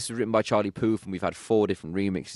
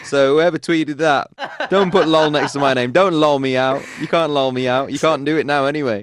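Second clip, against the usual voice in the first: mock scolding; 5 semitones higher, 8 dB louder than usual. Real, not acted. Playful rebuke of tweeter.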